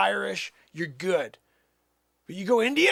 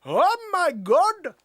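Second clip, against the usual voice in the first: slow, high Indian-accent exclamation; mock Indian accent; 8 semitones higher, 4 dB louder, much slower than usual. Shown as a demonstration. Showing himself mid-routine, drawn-out high Indian accent. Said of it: "When you're in the middle of doing a".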